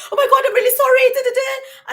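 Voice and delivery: high, fast panicked apology; flustered waitress. Retold or imitated. Apologetic waitress to Joyce Meyer, high and rushed. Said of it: "she was like".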